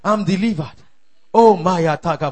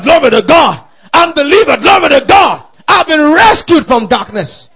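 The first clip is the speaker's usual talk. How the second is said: loud, jubilant shouted proclamations; full-force preaching; 6 semitones higher, 7 dB louder than usual. Real, not acted. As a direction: preach it out in rising triumph.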